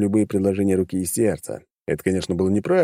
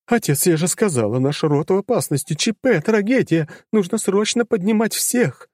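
high, insistent daughter repeating herself; 12 semitones higher than usual. A narrator's reading. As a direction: daughter nagging father. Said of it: "Не прекращала она тараторить одно и то же".

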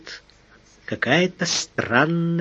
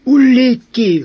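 much higher and louder burst; loud exclamation; 10 semitones higher, 7 dB louder, slower than usual. A narrator's reading. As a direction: blurt it out in alarm. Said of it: "выдохнул сержант".

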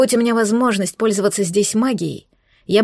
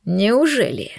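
menacing; slower than usual. A narrator's reading. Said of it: "прозвучало угрожающе".

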